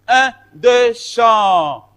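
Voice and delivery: counting in. Real, not acted. Teacher cueing the class to sound the vowel, called out. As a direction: call the beat, stretching the last word.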